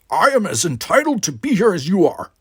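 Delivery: high, loud angry retort; indignant protest. A narrator's reading. Worf claims his place at Starfleet, indignant.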